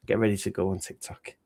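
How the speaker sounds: ordinary delivery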